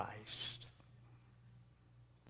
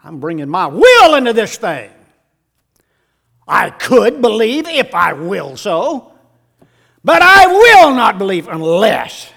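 loud, high, ringing sermon cry; shouting the Thomas story from the pulpit; full-force preaching; 8 semitones higher, 17 dB louder than usual. Acted. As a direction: preach it to the back row, much louder.

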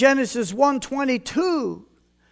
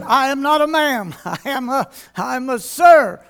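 retold indignant correction, louder on "sir"; mock indignant; 2 semitones higher, 5 dB louder than usual. Preacher retelling his reply to the receptionist, mock indignant. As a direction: play up the offended protest with a laugh breaking in.

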